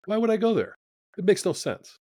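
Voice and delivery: high, disbelieving question to himself; puzzled self-doubt. Retold or imitated. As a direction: ask yourself why, baffled. Inner doubt about flying to Boston.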